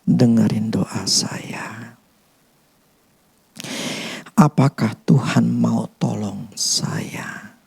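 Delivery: hushed, slow whispered doubts. Retold or imitated. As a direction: whisper the worried prayer questions slowly. Doubting believers' inner questions.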